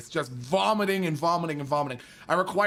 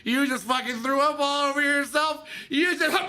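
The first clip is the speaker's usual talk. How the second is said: put-on high drunk disbelief; drunk incredulous yell; 8 semitones higher than usual. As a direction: point it out, sloppy and amazed. Drunk narrator mocking corporal.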